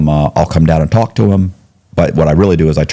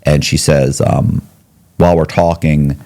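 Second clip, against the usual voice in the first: ordinary delivery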